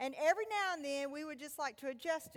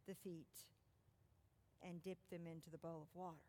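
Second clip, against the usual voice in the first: a preacher quietly painting the scene of Jesus washing feet; hushed storytelling; 5 semitones lower, 5 dB quieter than usual. A narrator's reading. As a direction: draw them in gently, almost hushed. Gentle retelling of the foot washing.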